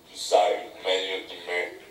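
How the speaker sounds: Bildad's tomb-like answer to Peleg, low and soft; sepulchral reply. A narrator's reading. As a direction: answer from the grave, deep and hushed. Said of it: "fu la sepolcrale risposta".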